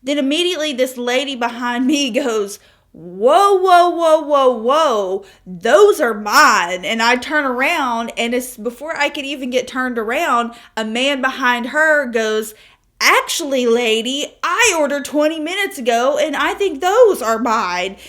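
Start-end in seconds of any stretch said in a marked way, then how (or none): 2.9-6.8 loud, high whoas, then slow those are mine; hammed-up outraged stranger; mock shout, slowing at the end; imitating a lady shouting. Lady claims croissants. 13.0-18.0 imitating an annoyed man. Man behind her objects.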